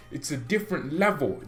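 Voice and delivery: ordinary delivery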